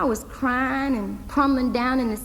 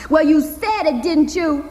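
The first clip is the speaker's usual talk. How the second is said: louder and higher accusation; accusing girl; 6 semitones higher, 6 dB louder than usual. Acted. Hazel accusing her uncle of breaking his word, louder. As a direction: throw it back at him.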